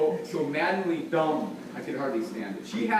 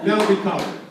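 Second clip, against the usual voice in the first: laughing; 3 semitones higher, 7 dB louder than usual. Real, not acted. Laughing after groom's answer.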